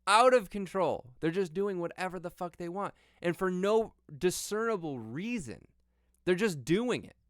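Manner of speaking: high, exasperated disbelief; outraged. Real, not acted. Outraged rant about anarchy.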